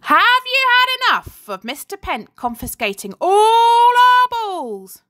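high, loud rabble-rousing call; rousing cry. A narrator's reading. Girl on the climbing frame rouses her classmates, loud. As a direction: fire up the crowd. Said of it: "she cried".

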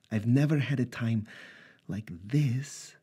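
Natasha's voice, tearful. Acted. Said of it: "Natasha said".

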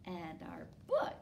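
ordinary delivery, nothing marked

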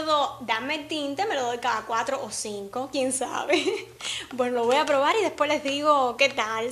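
ordinary delivery

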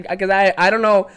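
ordinary delivery

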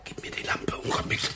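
gespanntes Flüstern. Acted. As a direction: knapp und tonlos flüstern, um nicht entdeckt zu werden. Schleichen sich nachts hinaus. (angespannt geflüstert)

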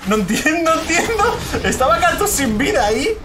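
cracking up at the absurd play; laughing disbelief. Real, not acted. (riéndose, incrédulo y agudo)

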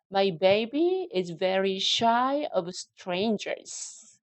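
very slow, careful model sentence; slow drill reading. Shown as a demonstration. Teacher reading the sentence slowly for students to echo. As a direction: say it slowly and evenly. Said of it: "같이 한번 읽어보실게요".

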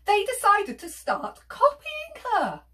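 high, loud storyteller's glee; excited reveal. A narrator's reading. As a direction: announce the cheeky game with excitement. Revealing the silly game.

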